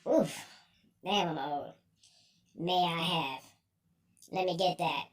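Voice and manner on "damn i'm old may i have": ordinary talk, nothing marked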